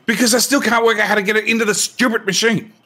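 high-pitched frustrated complaint; exasperated outburst. Real, not acted. Player defending himself over the machine, exasperated. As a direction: protest in exasperation, pitch jumping up.

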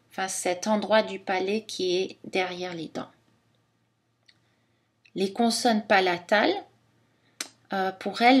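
usual speaking voice

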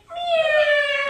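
high loud meow; cat meow. Acted. Teacher makes the cat sound in cat pose, high meow. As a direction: squeal out a big cat meow. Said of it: "into a cat pose and say".

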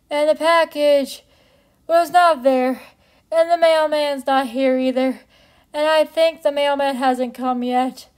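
short winded phrases with breaths between; talking while still catching breath. Real, not acted. Telling viewers about the run, still out of breath. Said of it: "now I'm out of breath".

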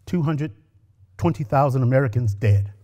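plain reading voice

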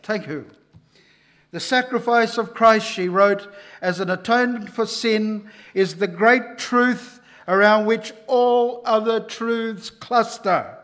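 usual speaking voice

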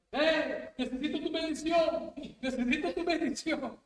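high, loud pleading shout; retold yelling. Stranger shouting to the pastor for a blessing, loud. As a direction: yell it like a stranger begging. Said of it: "sale el varón gritando".

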